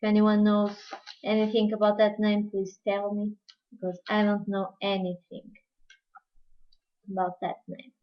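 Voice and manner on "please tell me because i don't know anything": normal talking voice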